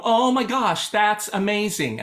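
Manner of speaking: light up and mean every word; excited. Shown as a demonstration. Teacher demonstrates the joyful version to her choir, bright and excited. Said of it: "And I'll go".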